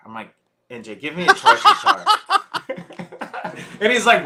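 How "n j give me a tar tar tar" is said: high, loud, breaking into laughter; helpless laugh through the words. Real, not acted. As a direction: crack up on the line. Cracking up retelling own direction.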